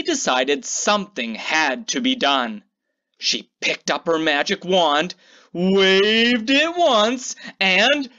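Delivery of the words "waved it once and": slow build-up. A narrator's reading. Slow build to magic spell.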